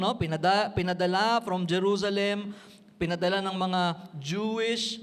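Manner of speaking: ordinary talk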